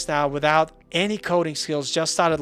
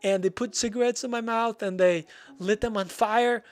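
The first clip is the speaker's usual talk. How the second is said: high-pitched put-on tearful complaint; retold sob story in a small, high voice; mock crying child; 7 semitones higher than usual. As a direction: act out a crying kid tattling to mom. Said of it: "I'm crying".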